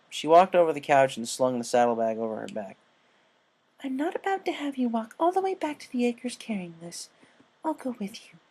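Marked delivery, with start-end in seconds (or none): 3.8-8.4 Fluttershy voice. Acted. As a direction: speak like a shy gentle mare.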